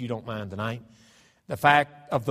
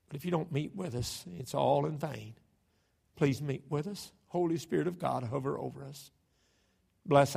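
prayer; 4 dB quieter than usual. Acted. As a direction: pray quietly, pleading for help. Prays before preaching.

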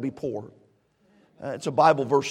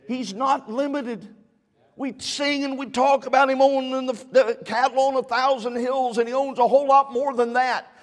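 rising, louder preacher's push; preaching; 8 semitones higher, 4 dB louder than usual. Acted. Preacher urging the congregation to ask God, rising to louder preaching. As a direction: build the congregation up to ask boldly.

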